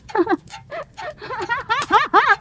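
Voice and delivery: high, wild, rising laugh; hysterical laughter. Acted. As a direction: break into unhinged laughter. Wife's hysterical laughter ending scene.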